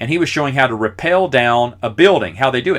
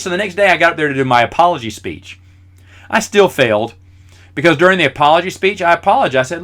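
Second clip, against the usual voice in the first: ordinary talk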